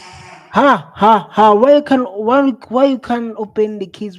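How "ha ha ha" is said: loud scornful ha ha ha; mocking laugh. Real, not acted. Streamer scoffing at the police refusing the case, mocking laugh. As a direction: laugh at the excuse in disbelief.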